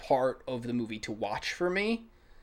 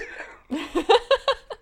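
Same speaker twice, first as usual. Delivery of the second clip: very high loud laughter; high shrieking laugh; 7 dB louder than usual. Real, not acted. Laughing hard.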